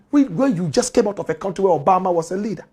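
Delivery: ordinary delivery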